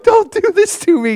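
put-on high pleading child voice; imitating the boy clutching the pastor's shirt; scared little boy. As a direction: beg like a terrified kid. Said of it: "you know, like".

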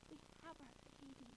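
barely audible, quick hush; imitated hushed announcement. Husband imitated from church doorway with baby news, very hushed. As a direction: mouth it very softly, almost without voice. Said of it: "this is what he does".

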